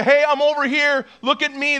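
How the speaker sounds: mock fan calling out, high-pitched; fan shout-out. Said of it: "This isn't like a fan shout out".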